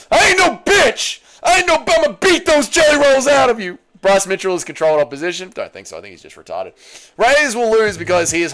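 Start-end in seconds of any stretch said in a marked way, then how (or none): 0.1-3.8 hammed-up crying threat, pitched way up; Raja Jackson impression. Streamer playing Raja Jackson threatening DC, high crying mock yell. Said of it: "Version of Ra Ra Jackson".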